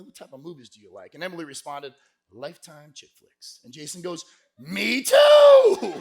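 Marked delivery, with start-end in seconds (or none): 0.0-1.1 low, soft imitation of a suitor; quiet, suave chat-up question; smooth flirt. 2.3-3.6 low, hushed imitation of a date; understated, soft-voiced reply; soft coy answer. Emily answering softly. 4.6-5.9 mock-thrilled high shout, last word drawn out; hammed-up fake enthusiasm; overeager agreement.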